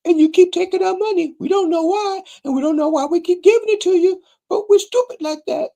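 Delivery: squeaky mock fan voice pleading to give money; mock adoring fan. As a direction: gush like a starstruck fan in falsetto. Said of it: "But most of them say".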